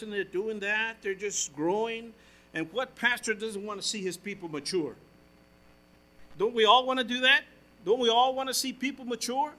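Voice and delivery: loud, rising preacher's call to the congregation; full-force preaching. Acted.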